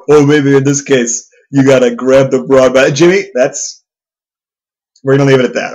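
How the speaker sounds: loud, high, swaggering guest voice, pleased with himself; cocky guest character. Acted. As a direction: play the smug self-proclaimed gentleman, loud and high. Jimmy Malone character chat.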